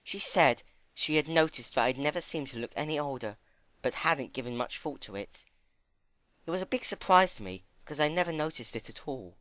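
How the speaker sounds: high, gentle pony voice; high timid pony. Acted. Fluttershy voiced.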